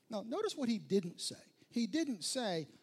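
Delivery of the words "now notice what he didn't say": usual speaking voice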